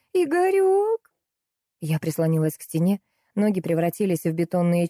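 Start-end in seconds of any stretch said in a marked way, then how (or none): 0.1-1.1 very high, sustained wailing call; howling. A narrator's reading. Salesgirl howls for her husband, high wail. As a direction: wail the name up onto a held note. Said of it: "выла девчонка".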